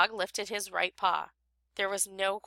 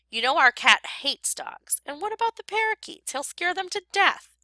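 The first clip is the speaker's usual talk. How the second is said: scolding wife; 8 semitones higher than usual. A narrator's reading.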